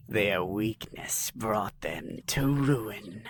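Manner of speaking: low, slow, menacing alien ruler. Acted. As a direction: drag each word out like a threat. Alien Skrung leader speaks.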